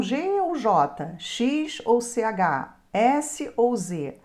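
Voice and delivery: G ou J, X ou C H, S ou Z slowly, letter by letter; slow spelling out. Shown as a demonstration. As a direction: spell each pair out slowly for students. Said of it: "spelling the letter pairs out". (letras ditadas bem devagar)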